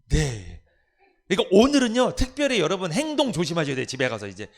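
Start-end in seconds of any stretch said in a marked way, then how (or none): none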